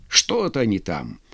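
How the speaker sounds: usual speaking voice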